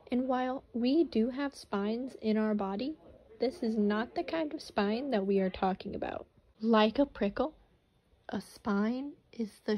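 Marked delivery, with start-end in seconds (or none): none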